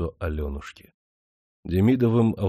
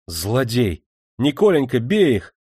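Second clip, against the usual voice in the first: a lightly voiced woman, louder; woman crying out; 4 semitones higher, 4 dB louder than usual. A narrator's reading. Alyonushka rushes in, calling out. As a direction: urge him to strike. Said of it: "раздался женский голос".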